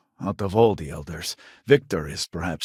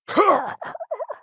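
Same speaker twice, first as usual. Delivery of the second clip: high laugh. Acted. Laugh with no words.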